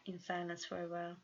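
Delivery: plain reading voice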